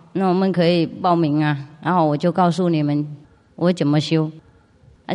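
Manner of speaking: ordinary delivery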